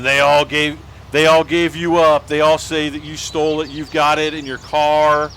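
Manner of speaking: loud retold accusation from an angry principal; angry principal accusing. Angry principal accusing the boy, loud retold yell. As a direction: bark the charge like a furious principal. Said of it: "his face turns blood red, and he's like".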